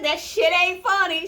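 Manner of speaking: light impression of an annoyed wife; imitating Hannah. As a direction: mimic her snapping it's not funny. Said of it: "She didn't say it ain't funny".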